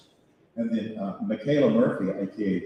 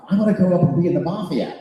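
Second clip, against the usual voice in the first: put-on eager, higher and louder like a young boy; kid's excitement; 7 semitones higher, 9 dB louder than usual. Young boy after The Godfather, eager and loud. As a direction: gush with childish enthusiasm. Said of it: "my reaction to the film".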